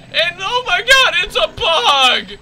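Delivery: loud, high-pitched cry of alarm; mock alarm. Player spots a bug, loud hammed-up alarm. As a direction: shout it high, stretching the end.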